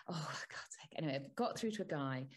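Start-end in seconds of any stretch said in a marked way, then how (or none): 0.1-0.9 whispered under the breath in rising rage; soft exasperated whisper. Retold or imitated. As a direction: hiss it to yourself, fed up.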